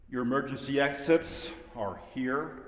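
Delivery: usual speaking voice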